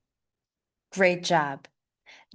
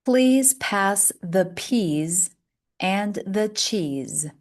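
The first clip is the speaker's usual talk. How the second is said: slow model reading; slower than usual. Shown as a demonstration. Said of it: "Repeat after me".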